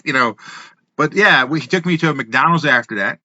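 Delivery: normal talking voice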